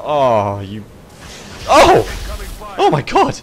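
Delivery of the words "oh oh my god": high, loud startled cry; startled yelp. Real, not acted. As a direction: jolt in fright. New enemy appears.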